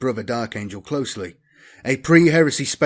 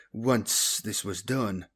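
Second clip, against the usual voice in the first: ordinary delivery, nothing marked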